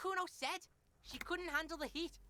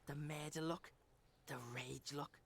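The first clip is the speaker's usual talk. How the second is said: much lower, soft menacing whisper; 13 semitones lower, 6 dB quieter than usual. Acted. Kuno menacing the detective, whispered. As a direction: breathe it out like a threat.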